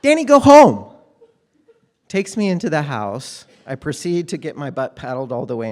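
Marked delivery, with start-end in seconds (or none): 0.0-0.9 very loud, high shout; mom ordering. Retold or imitated. Mom sending the neighbor boy away, loud angry shout. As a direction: send the boy home with a sharp yell. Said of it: "My mom snatches me by the arm and says".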